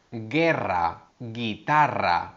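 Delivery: "guerra" and "guitarra" with a light, soft g; careful teacher demo of soft g. Shown as a demonstration. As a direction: model the soft g before e and i. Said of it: "G U más E I".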